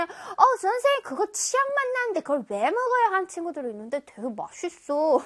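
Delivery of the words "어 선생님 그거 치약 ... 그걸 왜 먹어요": high, incredulous pupil voice; objecting student. Acted. Pupil protests teacher's liking mint chocolate, objecting in high voice. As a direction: pitch it up like a protesting kid. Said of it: "어떤 친구들은".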